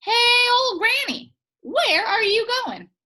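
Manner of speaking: high, loud calling cow patty. Acted. Cow patty calling to Granny, high and loud. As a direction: call out high like a silly talking cow patty. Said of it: "it spoke to her".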